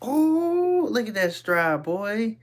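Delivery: hyped about his own stride; cheering on his past self; real delight. Real, not acted. Admiring own old video.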